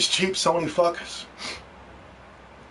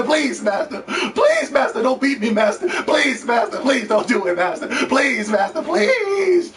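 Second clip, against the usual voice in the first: mock pleading; 6 semitones higher than usual. Slaves pleading in retold ad.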